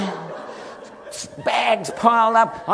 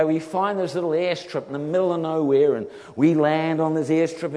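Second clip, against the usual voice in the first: ordinary talk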